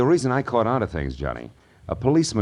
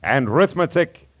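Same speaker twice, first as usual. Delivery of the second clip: higher, punchy detective voice-over; dramatic teaser; 6 semitones higher, 5 dB louder than usual. Acted. Marlowe teasing next week's murder story, dramatic announcing. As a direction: sell next week's mystery, pausing between clauses.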